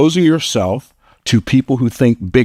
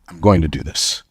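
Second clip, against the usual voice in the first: deciding; 6 semitones lower than usual. Shown as a demonstration. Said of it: "instead of deciding".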